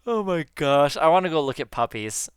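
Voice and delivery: talking through a yawn; words stretched by a yawn. Real, not acted. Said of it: "Ah, I yawned".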